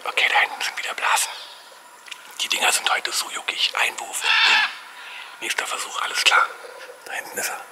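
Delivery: fast, breathy whisper. Real, not acted. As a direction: whisper so the fish stay close. Whispering to not scare fish.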